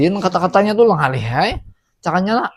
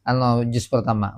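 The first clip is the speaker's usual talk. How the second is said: usual speaking voice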